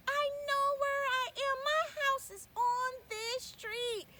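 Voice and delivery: high, loud and slow; relieved child recognizing her street; loud, joyful little girl; excited child. Acted. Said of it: "they turned up that street".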